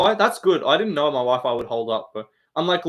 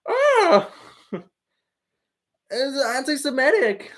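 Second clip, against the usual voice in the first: high whiny put-on voice, much higher; mock fighter impression; 11 semitones higher, slower than usual. Streamer mocking a fighter for chat, high whiny voice. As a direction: yelp it out as a whining fighter. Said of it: "And you go".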